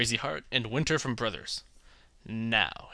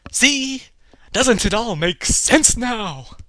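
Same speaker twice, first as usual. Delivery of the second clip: higher, louder, slow smug know-it-all; smug artist voice; 8 semitones higher, 7 dB louder, much slower than usual. Pretend. Painter smugly asking the baffled viewer, higher and slow. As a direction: drag out the self-satisfied question.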